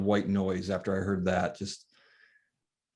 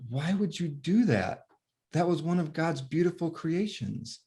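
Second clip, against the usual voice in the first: put-on higher, slow little-boy voice, gently reproachful; young son; 8 semitones higher than usual. Small son reproaches his father for killing the bee, slow higher child voice. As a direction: play the innocent boy, higher and slow, dismayed.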